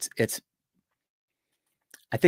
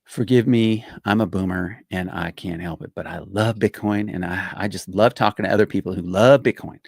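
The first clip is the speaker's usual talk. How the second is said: normal talking voice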